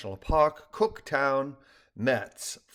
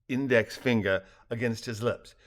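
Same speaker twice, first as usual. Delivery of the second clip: usual speaking voice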